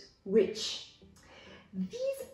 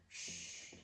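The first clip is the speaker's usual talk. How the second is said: a soft, breathy shh like keeping a secret; shushing; 20 dB quieter than usual. Shown as a demonstration. Teacher to students explaining secret, whispered shushing. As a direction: hush the class with a whispered shh.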